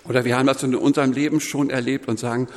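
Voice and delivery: ordinary delivery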